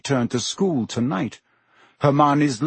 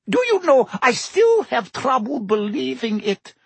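high-pitched musing landlady; thoughtful; 11 semitones higher than usual. A narrator's reading. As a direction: muse aloud in a high voice. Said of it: "said Madame Rosmerta thoughtfully".

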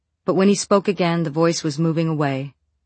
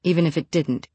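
ordinary delivery

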